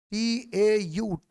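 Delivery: letters spelled one by one, very slow; spelled out slowly. Shown as a demonstration. Teacher spelling tau letter by letter, very slow. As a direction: spell each letter out for the class. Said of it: "इसको पढ़ेंगे टाउ".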